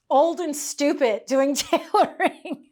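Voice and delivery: real laughter breaking into the words; laughing. Real, not acted. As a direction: laugh at yourself as you say it. Laughing at the tailor's insult.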